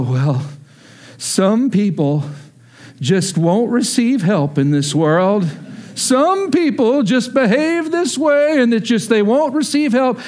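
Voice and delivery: slow, drawn-out preachy pronouncement; mock pious lecture. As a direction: deliver it like a smug know-it-all, slowly. Mocks his own pious answer.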